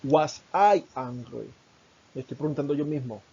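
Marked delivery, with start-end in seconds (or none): 0.0-1.5 slow, deliberate question for students; slow teacher demonstration; slow model question. Shown as a demonstration. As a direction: say each word slowly and clearly. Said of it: "por ejemplo".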